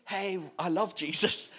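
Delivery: mock chirpy Jesus fan; lightly mocking cheerful praise; cheery believer. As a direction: toss it off like a jolly churchgoer. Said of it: "not in the ... kind of way".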